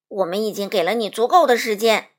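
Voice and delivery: loud, indignant protest; angry. A narrator's reading. Tin Woodman telling the wizard off, angry. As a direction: raise the voice in annoyance. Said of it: "铁皮伐木工生气地说".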